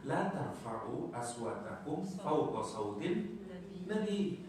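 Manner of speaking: slow chanted recitation of the verse; chanting a Quran verse to the congregation; Quranic recitation. Shown as a demonstration. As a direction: recite it slowly and reverently.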